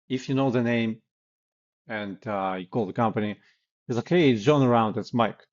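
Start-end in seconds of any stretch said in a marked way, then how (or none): 4.1-5.5 casual command. Shown as a demonstration. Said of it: "And you don't say".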